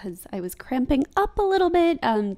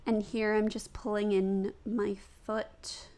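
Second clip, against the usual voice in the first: normal talking voice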